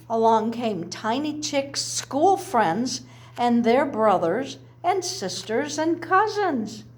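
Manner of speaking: plain reading voice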